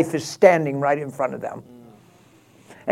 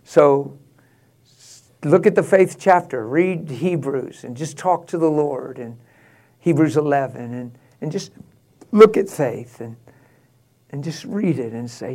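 ordinary talk

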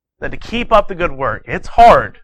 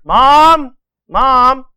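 high, loud call for Mom; child calling out; 7 semitones higher, 5 dB louder than usual. Acted. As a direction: holler higher for Mom from bed. Boy yells again from bed.

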